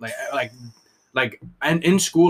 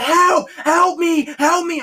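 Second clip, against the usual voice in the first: much higher, louder shrieked help me; mock cry for help; 5 dB louder, much slower than usual. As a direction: ham up a panicked teacher screaming for help. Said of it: "She's like".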